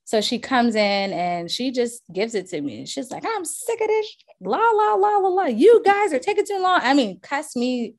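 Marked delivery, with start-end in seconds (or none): 3.2-6.8 high, loud exasperated whine, mocking the buyer; mock angry buyer. Agent mimicking the frustrated buyer, high mocking rant. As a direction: whine it way up high like a fed-up buyer blowing off steam.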